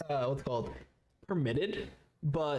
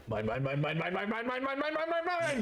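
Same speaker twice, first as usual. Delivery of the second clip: charging blue dragonborn; 7 semitones higher than usual. Acted. Game master voices a dragonborn running in to tackle someone, rising frantic chant. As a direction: repeat it like a rising siren. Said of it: "a very tall blue dragonborn going".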